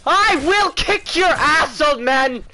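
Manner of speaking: high, loud, drawn-out threat; shouted threat. Real, not acted. Gamer threatens the old man he is fighting, shouted threat. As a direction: yell a slow challenge at your foe.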